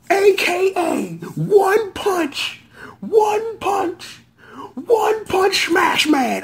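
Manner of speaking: high, slow, shouted name; hyped shout. Real, not acted. Hyping the new rider's nickname with a long high shout. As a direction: announce him like a champion.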